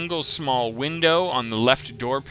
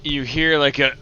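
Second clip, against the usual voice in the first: ordinary talk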